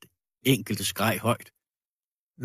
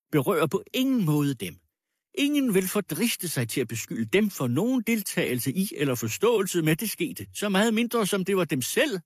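higher, emphatic speechifying; pompous orator; 8 semitones higher, 3 dB louder than usual. Acted. Accuser magnanimously addressing the lady and crowd, pompous. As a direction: declaim it point by point to a room.

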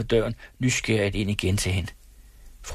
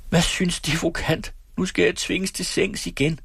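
shouting; 9 semitones higher than usual. A narrator's reading. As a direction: cry out in helpless protest. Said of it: "råbte fru Syvers". (råbende)

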